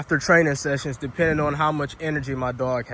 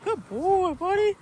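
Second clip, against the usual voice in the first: high, bright praise for the dog; squeaky praising a dog; slower than usual. Real, not acted. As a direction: coo praise at your dog. Praising dog after a catch.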